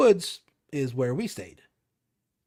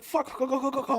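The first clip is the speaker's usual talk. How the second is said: retold panicked urging; 12 semitones higher than usual. Retold friend urging him to flee.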